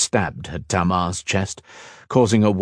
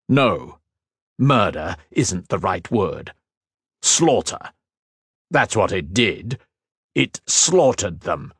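ordinary delivery

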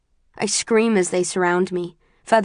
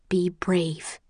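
hushed urging; 6 dB quieter than usual. A narrator's reading. Remembered voice steadies narrator inside hallucination, whispered.

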